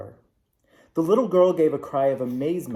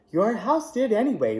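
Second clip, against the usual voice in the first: put-on higher, amused old woman; with a laugh; 8 semitones higher than usual. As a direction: say it lightly, half laughing. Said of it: "replied the little old woman with a laugh".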